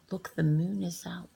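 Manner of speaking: usual speaking voice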